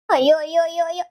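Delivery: loud drawn wail of protest. Acted. Grandmother wails at daughter-in-law's insult, loud. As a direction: wail out in dismay.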